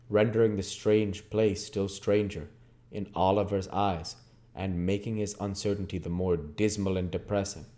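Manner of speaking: plain reading voice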